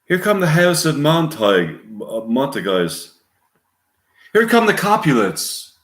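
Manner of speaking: put-on high English-accented stage announcement. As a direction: call it out in a theatrical English accent. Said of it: "English accent".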